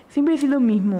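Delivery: a hint of a snide friend, imitated; mocking nasty tone. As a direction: mimic his snide line lightly. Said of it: "en un tono muy hijo de puta". (imitando con desprecio leve)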